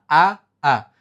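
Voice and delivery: ordinary talk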